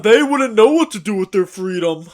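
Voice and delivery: loud, put-on voice of a smug slaveholder; mock apologist voice. Friend mocking the pro-slavery talking point in a smug put-on voice. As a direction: parrot the old excuse in a smug character voice.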